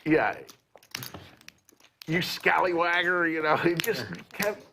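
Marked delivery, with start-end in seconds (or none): 2.1-3.3 slow, high cowboy impression; old cowboy voice. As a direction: drawl it like a crusty old cowboy. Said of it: "into the character".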